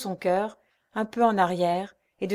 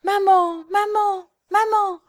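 put-on child's voice much higher, crying out; frightened little boy; 11 semitones higher, 5 dB louder, slower than usual. A narrator's reading. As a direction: call for mother like a scared child. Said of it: "tout effaré". (petit garçon effaré, voix aiguë)

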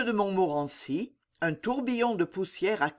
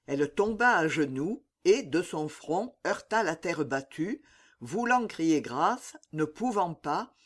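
ordinary delivery

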